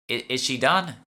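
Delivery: a hint of a hurried shopper; impatient young shopper. Lisa asking the shopwoman, high and impatient.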